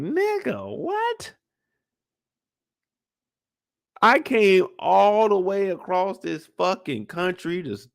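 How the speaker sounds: can't believe what he's hearing; outraged disbelief. Acted. Joel outraged at Tommy's refusal.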